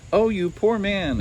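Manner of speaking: high, slow cry of pity; exclaiming. Acted. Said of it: "exclaimed Zeralda".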